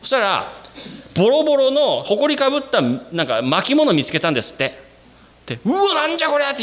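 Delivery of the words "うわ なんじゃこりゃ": high, startled "what on earth"; startled discovery. Retold or imitated. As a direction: gasp it out like you just found something strange. Finding the dusty scroll.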